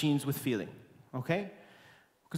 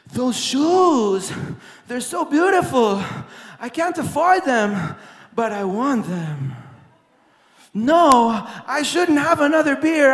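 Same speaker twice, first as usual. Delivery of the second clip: tempted inner voice; 6 semitones higher, 10 dB louder, slower than usual. Retold or imitated. Voicing an inner craving.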